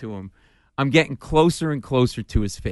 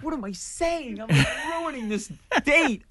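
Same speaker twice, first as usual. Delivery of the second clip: panicked inner voice; 12 semitones higher, 5 dB quieter than usual. Retold or imitated.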